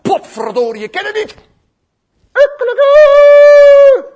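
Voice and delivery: loud, high flustered outburst; hammed-up angry denial; mock cursing. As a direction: swear and deny, ending in a drawn-out crow. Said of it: "dan begint hij zelfs te vloeken".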